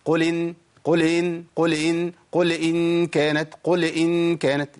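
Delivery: "قل ان" with a little vowel bounced after the l, like "qule in"; slow chant, "قل" wrongly ending in a short vowel; shaken lam. Shown as a demonstration. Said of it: "بيحرك الساكن".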